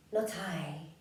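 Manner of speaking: a light hint of a sly snake; soft, sly little denial. Snake answers the Gruffalo's child, lightly voiced. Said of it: "said the snake".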